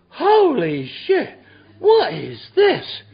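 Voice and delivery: high, slow, stunned gasp of disbelief; high drawn-out holy shit; shocked exclamation. Retold or imitated. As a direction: gape at what you see. Shocked peek into the meeting.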